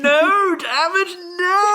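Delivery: high, loud hammed-up no; mock anguished shout. Hosts imitating the cardinal, loud high mock cry. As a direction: wail it like the thwarted bird. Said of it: "On the back end going".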